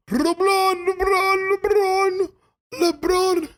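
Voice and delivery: put-on very high, sustained French-accented chant. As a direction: call out the name in a hammed-up French accent. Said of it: "if that's my French accent".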